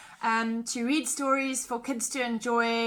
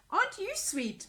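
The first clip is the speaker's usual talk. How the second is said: doting mother; 8 semitones higher, 3 dB quieter than usual. Acted. Mother dragon coos at baby.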